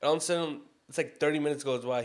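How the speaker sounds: normal talking voice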